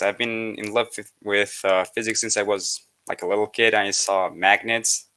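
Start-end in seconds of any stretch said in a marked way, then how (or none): none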